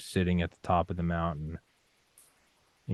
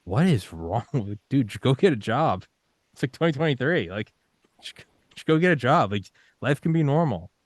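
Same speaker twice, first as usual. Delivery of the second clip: higher, louder exasperated lecturing; mock scolding critic; 5 semitones higher, 3 dB louder than usual. Outsider scolding him to just get a job, higher, louder mock exasperation. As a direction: nag like a baffled outsider. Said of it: "Someone else might look at me and be like".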